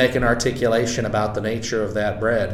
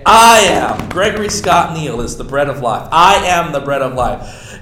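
mock grand claim; 4 semitones higher, 6 dB louder than usual. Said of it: "if I were to sit out here and say".

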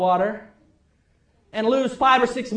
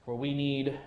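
prayer; 7 semitones lower, 3 dB quieter than usual. Acted. Pastor leads the congregation in prayer, soft and reverent. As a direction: pray quietly over the congregation.

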